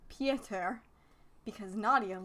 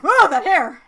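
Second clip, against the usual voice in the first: loud amazed cry; surprised exclaim; 6 semitones higher, 17 dB louder than usual. Acted. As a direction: gasp it out, amazed. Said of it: "And he's like".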